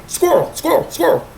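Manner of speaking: high, soft mock squirrel cry; distracted squirrel brain. Showing how her mind jumps, high soft squirrel calls. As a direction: play the easily distracted mind. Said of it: "in my head, it's just like".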